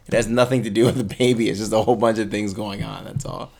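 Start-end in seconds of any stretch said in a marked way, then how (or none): none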